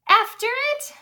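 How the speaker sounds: mock whisper; crew whispering. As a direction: ask it nervously like the crew. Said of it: "we all whispered".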